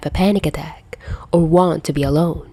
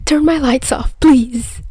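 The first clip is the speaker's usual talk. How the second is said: high, strained, near breaking; calling out while barely holding together; strained and wobbly; 9 semitones higher than usual. Acted. Peter asking Friday for darkness, high strained voice. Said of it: "His voice was strained and wobbly".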